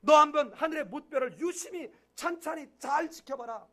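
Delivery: lightly voiced God telling Abraham to gaze at the stars; God urging Abraham, lightly voiced. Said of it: "찬찬히 오랫동안 자세히 유심히 지켜본다는 말".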